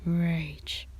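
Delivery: ordinary delivery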